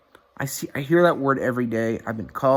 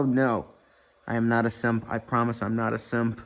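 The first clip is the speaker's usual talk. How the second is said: ordinary delivery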